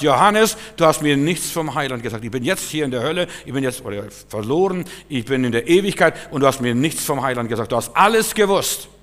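loud, high-pitched reproach from the dead friend; anguished accusation. Retold or imitated. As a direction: cry out an accusation as the lost friend. Dead friend accuses him in dream.

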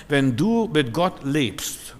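very slow, weighty preaching; slow emphasis. Real, not acted. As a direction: lean on each word.

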